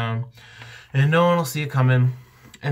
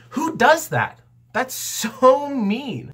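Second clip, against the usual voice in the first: much higher, slow outraged disbelief; indignant; 12 semitones higher, slower than usual. Real, not acted. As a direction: squeal out how unfair it is. Indignant at rude man.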